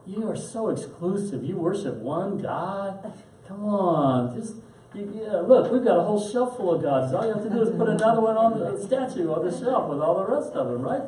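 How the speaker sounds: high-pitched, teasing pagan cajoling monotheists; mocking Greek pagan. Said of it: "the Greeks want to force them".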